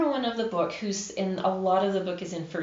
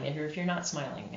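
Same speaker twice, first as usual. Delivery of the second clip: quick, softer delivery without a smile; unsmiling voice; 2 semitones lower, 7 dB quieter, faster than usual. Shown as a demonstration. Narrator showing that listeners hear no smile, unsmiling voice. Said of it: "hear that in your voice".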